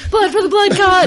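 laughing, high, loud war cry; battle cry shout. Acted. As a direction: bellow it like a crazed cultist. Joining the blood frenzy joke.

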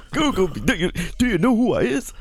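high, boastful put-on voice; mock superstar brag. Repeated [name] boast.

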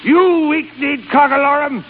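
high, indignant nautical insults; angry tirade. Acted. Boss berates Peasley for quitting, outraged bluster. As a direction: build a blustering tirade, hurling each insult.

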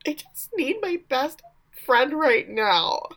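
high plea drawn out at the end; mock needy plea. Teen begs her best friend in a retelling, mock needy.

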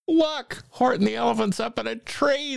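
very high, quick mocking jeer; taunting. A narrator's reading. Friends mocking the elephant up a tree, high taunting. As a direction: jeer in a squeaky high pitch. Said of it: "they taunted".

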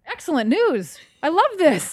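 loud, high, delighted; excited. Real, not acted. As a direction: burst out with joy. Delighted reaction to good news.

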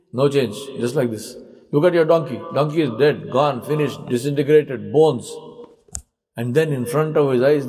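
ordinary talk, nothing marked

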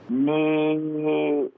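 ordinary talk

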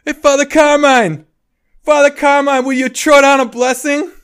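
loud high shout calling up to a window; yelling up to a window. Retold or imitated. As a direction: call up to the priest's window at full voice. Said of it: "just yells up to the window".

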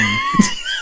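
sudden high squeal of laughter; high burst of laughter. Real, not acted. Caught doing weeb voice.

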